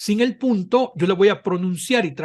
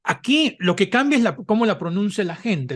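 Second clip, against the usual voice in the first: usual speaking voice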